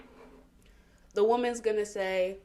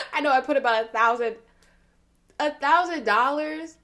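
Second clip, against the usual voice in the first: louder, higher mocking disbelief, slowing on thousand; incredulous mock; 5 semitones higher, 9 dB louder than usual. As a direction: repeat it like it's ridiculous. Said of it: "I started laughing at that".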